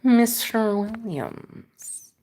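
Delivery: very slow, soft, drawn-out Mister Williams; Matrix agent voice. Pretend. Repeating a viewer's name in a slow agent voice. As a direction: savor each syllable like a villain. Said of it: "the accents you do".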